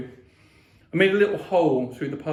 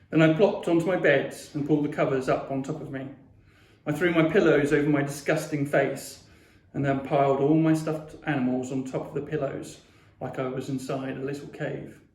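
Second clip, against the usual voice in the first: usual speaking voice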